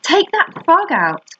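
high, slow, drawn-out command; high-pitched acted order, stretched out. A narrator's reading. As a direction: order it firmly in a high storybook voice. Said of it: "ordered Fern".

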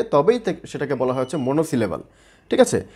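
ordinary talk, nothing marked

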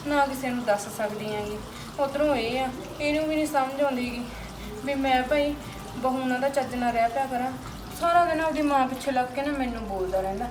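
sorrowful lament. Acted. Wife alone, lamenting her hard married life, sad and quiet.

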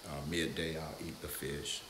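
ordinary talk, nothing marked

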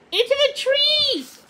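very high, loud command; urgent shout. A narrator's reading. Odette shouting to Puffin to dive into the trees. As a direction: shout the escape. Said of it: "Odette said".

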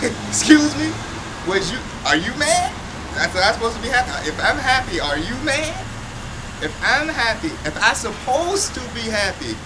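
high-pitched, affronted comeback; worked-up, incredulous challenge; indignant. Acted. Affronted comeback to being told be happy.

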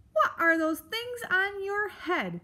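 high, gruffly demanding creature voice; growling grumpy beast. Acted. Beast demands to know about mouse's ears, growling. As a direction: growl the question rudely. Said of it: "the beast growled".

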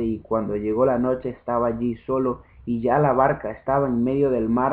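plain reading voice

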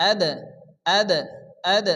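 আদ with a light bouncing release on the d; qalqala bounce. Shown as a demonstration. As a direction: give the d of আদ a small echoing push. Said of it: "হালকা ধাক্কা দিয়ে কলকলা করে".

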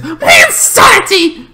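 high, put-on manic squeal; playful craziness. Vlogger showing off own craziness to viewers, manic. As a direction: burst out high, playing up the madness. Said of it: "because I know my craziness".

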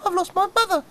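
high, fast chick voice; tiny squeaky chick; cheeping chick. Acted. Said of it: "cheep little chick".

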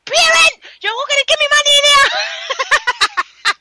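high, drawn-out mock shout; retold angry demand. Repeating a mother's rent demand, mock furious. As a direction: mimic a mother hollering for the rent. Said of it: "she said to them".

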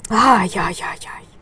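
whispered ay ay, worried; fretful whisper. Real, not acted. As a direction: breathe the worry out in a whisper. Fretting over stitches.

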